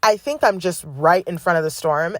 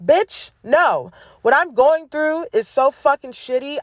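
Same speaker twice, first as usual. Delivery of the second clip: defiant retort; 8 semitones higher than usual. Real, not acted. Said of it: "And it's like".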